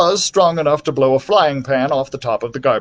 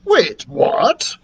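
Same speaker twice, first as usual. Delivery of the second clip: lightly voiced, higher-pitched dragon; puzzled dragon; 8 semitones higher than usual. A narrator's reading. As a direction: ask it in bewilderment. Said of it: "Dribble asked".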